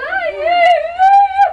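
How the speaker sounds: slow, high, loud scream of fright; frightened scream. Real, not acted. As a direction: shriek it out in real fear. Scared scream at horror film.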